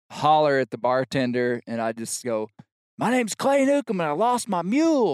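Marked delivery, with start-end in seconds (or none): 3.0-5.2 raised shouting voice; yelling over noise. Retold or imitated. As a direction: call out over the racket. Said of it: "I'm yelling".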